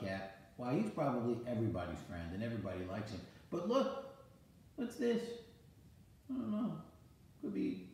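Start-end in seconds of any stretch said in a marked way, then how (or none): 3.5-7.9 mock surprise. Grandpa shows curiosity to child.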